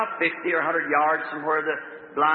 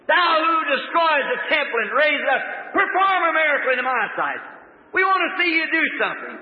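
higher, loud taunting demand; mocking challenge; 8 semitones higher, 3 dB louder than usual. Scoffers demanding a miracle in their sight, taunting. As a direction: dare him, voice raised.

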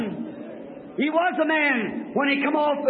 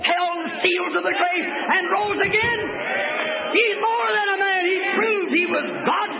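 full-force preaching; 4 semitones higher than usual. Real, not acted. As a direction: lift the room toward glory. Preaching climax on Easter.

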